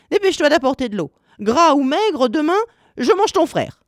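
high, insistent witch's threat; impatient. A narrator's reading. Witch tired of waiting threatens to eat Hansel, impatient. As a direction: order her about at a high, urgent pitch. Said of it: "Alors elle perdit patience".

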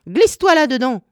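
high, forceful witch's order; commanding. A narrator's reading. Witch ordering Gretel into the oven, commanding. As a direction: order her in, pitched high. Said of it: "ordonna-t-elle".

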